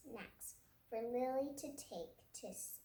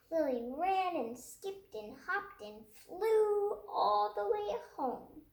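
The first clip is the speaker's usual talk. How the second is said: excited narration; 7 semitones higher, 6 dB louder than usual. A narrator's reading. Joyful climax narration.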